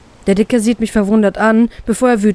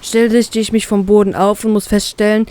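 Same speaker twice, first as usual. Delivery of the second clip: ordinary delivery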